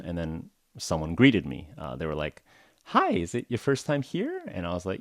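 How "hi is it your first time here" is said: staff greeting. Retold or imitated. Receptionist greets a first-time visitor, high polite voice. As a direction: put on a high, polite front-desk voice.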